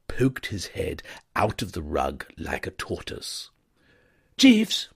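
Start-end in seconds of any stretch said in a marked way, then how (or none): 4.4-4.9 high, loud hiss-free call; young gentleman hiding; urgent toff. A narrator's reading. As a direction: summon the valet urgently. Wooster voiced.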